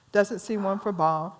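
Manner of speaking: usual speaking voice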